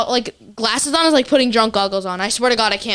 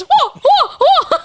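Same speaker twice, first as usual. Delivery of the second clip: high, loud mocking karate yells; karate cries; 5 dB louder, much slower than usual. Daughter imitating mom's karate yells, high loud. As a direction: yell Whoa, whoa like a movie fighter. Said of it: "all I can hear from my room is".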